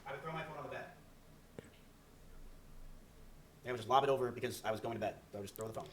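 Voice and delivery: talking with a toothbrush in mouth; while brushing teeth. Shown as a demonstration. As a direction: mumble through brushing teeth. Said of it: "I will throw you and show you an example".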